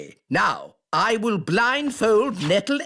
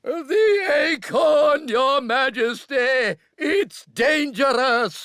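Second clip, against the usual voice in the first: urgent warning shout; 4 semitones higher than usual. Acted. Squirrel-disguised elf shouting a warning to the Queen. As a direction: shout the warning across to her.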